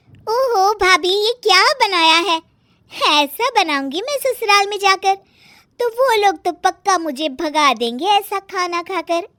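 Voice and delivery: high-pitched mock dismay; high, gleeful young woman ribbing; sister-in-law, mocking. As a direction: tease the cook, drawing out the first exclamation.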